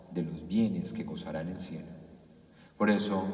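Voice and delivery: ordinary talk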